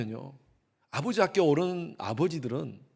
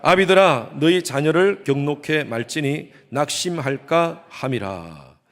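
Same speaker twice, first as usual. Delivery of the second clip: slow, loud reading in unison; congregational recitation; 7 dB louder, slower than usual. Real, not acted. Pastor leading the congregation reading scripture slowly aloud. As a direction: recite the verse slowly together. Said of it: "다 같이 시작".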